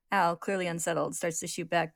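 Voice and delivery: plain reading voice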